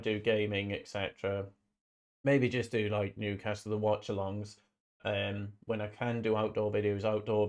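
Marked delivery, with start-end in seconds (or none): none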